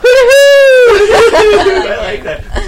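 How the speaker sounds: very high, loud, slow laughing cry; laughing squeal. Real, not acted. Laughing delight at the buzzer.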